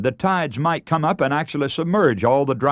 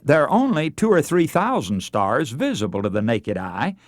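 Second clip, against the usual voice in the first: normal talking voice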